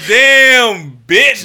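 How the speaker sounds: loud, high drawn-out damn; loud exclaim. Retold or imitated. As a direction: belt it out in awe. Speaker joins the gawking.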